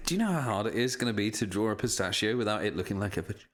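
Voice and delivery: ordinary talk, nothing marked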